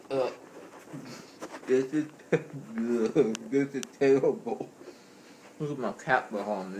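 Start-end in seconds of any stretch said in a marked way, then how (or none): 0.1-4.7 laughing. Real, not acted. Choking laugh mid cinnamon challenge.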